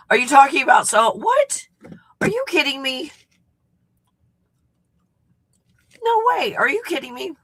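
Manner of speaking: high, loud incredulous questions; can't believe the generous offer; stunned disbelief. Real, not acted.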